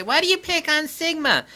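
high, whiny mock asker; mock questioner. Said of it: "people ask me, say".

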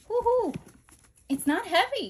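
high, loud woohoo of triumph; celebrate, loud and high; cheer. Real, not acted. Binder closes despite the pins, loud triumphant high cheer.

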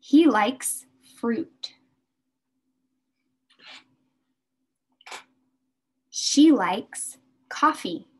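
slow, clear drill with "likes" stressed; slow model sentences. Shown as a demonstration. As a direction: model each sentence slowly for the class to repeat.

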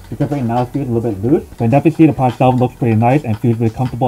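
muffled behind full-face mask; speaking inside snorkel mask; through a face mask. Real, not acted. Seen 1: Full-face snorkel mask worn over mouth and nose throughout.

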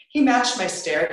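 plain reading voice

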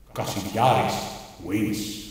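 deep, slow mock fighting-game announcer. Comic declares a winner in mock arcade announcer voice.

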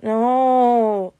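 long drawn-out loud protest; stretched, complaining no; whining. A narrator's reading. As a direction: whine like a reluctant kid. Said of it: "Peter whined".

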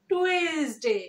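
slow "tu-ES-day". Shown as a demonstration. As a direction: stretch টুয়েসডে into three syllables. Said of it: "এইভাবে উচ্চারণ করি".

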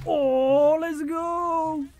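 high hyped let's go; high cheering let's go; excited cheer. Real, not acted. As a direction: celebrate the play. Hype cheer.

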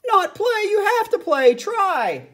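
high, loud alarmed pleading. Acted. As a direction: urge her on in a panic. Conductor alarmed by elephant.

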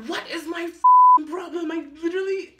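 loud, high frustrated yell; high-pitched self-directed rant; exasperated outburst. Real, not acted. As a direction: explode at your own mistake. Frustrated with own mistake.

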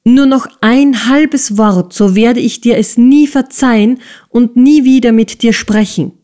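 scolding threat. A narrator's reading. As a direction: warn him off sharply. Miss Price threatening her fiancé. (drohend und höher)

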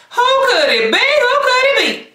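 teasing high voice. Acted. Teasing guess about hidden guest.

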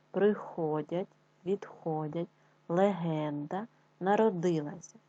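each word said slowly with its stress marked, like "престарого" with stress on "ро"; careful word stress. Shown as a demonstration. Teacher models correct stress on words from the legend, slow and clear. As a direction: spell out stress in "пам'ятаю", "однакова", each word apart and slow. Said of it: "розставляючи наголос".

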